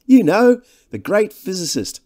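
high, airy boast; slender Devine. A narrator's reading. Devine airily boasts of companion.

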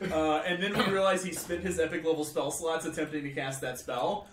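ordinary talk

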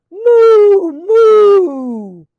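very loud, high, long-drawn moos; mooing cow. A narrator's reading. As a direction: belt out big cow moos. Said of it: "The cow said".

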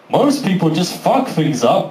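normal talking voice

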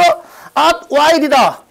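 "At while" hit hard and drawn out; forceful reading. Shown as a demonstration. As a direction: read "At while" slowly with firm punch. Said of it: "세게 읽어야 되잖아".

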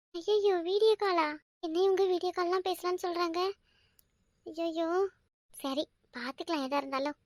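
soft, flustered murmuring to herself; woman murmuring in a fluster over a video call. Acted. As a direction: panic quietly about the video call.

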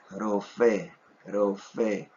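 "Rofe" with one light tapped r; soft Hebrew r. Shown as a demonstration. Teacher repeating rofe with the soft r. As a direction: tap the r gently in "Rofe". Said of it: "así tiene que ser nuestra pronunciación".

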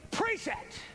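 high called-out preach it; imitating a preacher. Preacher imitating Jonathan Edwards calling out, high. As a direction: shout it up like an old revivalist. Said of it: "Jonathan Edwards would say".

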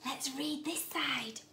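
hushed secretive whisper. Acted. Teacher drawing children to the next page in a whisper.

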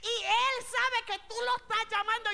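loud, high preaching in Spanish and English; full-force preaching. Acted. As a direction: preach it out to the whole church. Preacher addressing congregation.

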